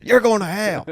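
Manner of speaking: high, loud mock condemnation; shouted damnation. Comic repeating his curse for laughs, mock damnation shout. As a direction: call it out like a gleeful street curse.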